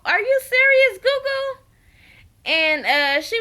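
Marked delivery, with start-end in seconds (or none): 0.0-1.6 much higher, mock-shocked Are you serious; imitating a friend's disbelief; incredulous. Erica doubting Goo's trust in Mitch, incredulous. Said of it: "And Erica was like".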